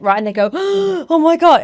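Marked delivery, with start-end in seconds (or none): 0.6-1.0 mock horrified gasp; shocked gasp. Acts out onlookers' shocked reaction. 1.1-1.6 higher, louder pretend horror; mock shock. Acts out onlookers' shocked reaction.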